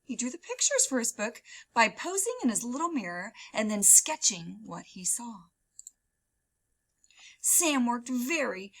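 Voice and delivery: ordinary delivery, nothing marked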